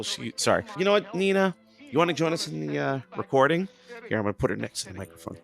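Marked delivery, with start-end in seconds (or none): 0.8-3.7 high, playful invitation to a pet; talking to dog. Real, not acted. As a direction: lift the pitch to coax a dog. Inviting her dog to join.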